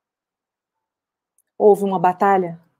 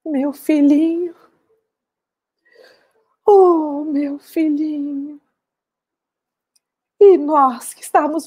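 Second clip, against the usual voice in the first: weeping mother's lament; 6 semitones higher than usual. Acted. Mother at her son's burial, crying in lament. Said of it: "ela começou a chorar, a chorar muito".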